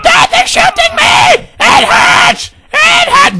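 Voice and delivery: put-on piercing falsetto child shrieks; screaming son. Son being shot, shrieking to Dad in agony. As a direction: shriek in pain like a panicked kid.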